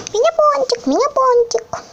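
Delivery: high little donut voice; squeaky toy voice. Pretend. (писклявый голосок игрушки)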